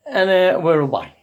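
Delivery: usual speaking voice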